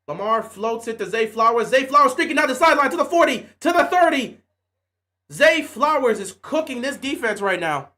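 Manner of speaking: hyped sideline commentary; play-by-play call. Acted. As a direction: narrate the long pass like a TV announcer. Calls his own game play-by-play.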